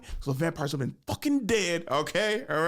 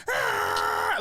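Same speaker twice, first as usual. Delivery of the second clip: hyped shout; 9 semitones lower than usual. Retold or imitated. Hyped by the theme song.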